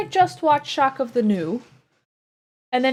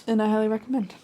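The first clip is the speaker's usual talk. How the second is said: usual speaking voice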